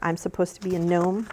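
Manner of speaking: usual speaking voice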